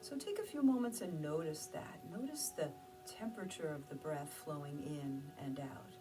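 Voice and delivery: hushed calming guidance; guided meditation. Acted. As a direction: guide them into stillness, gently. Leads a meditation.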